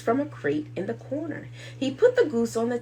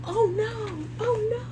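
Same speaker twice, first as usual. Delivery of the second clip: high frightened cry; panicked; 12 semitones higher than usual. Acted. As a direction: squeal in panic. Jack panicking as giant chases.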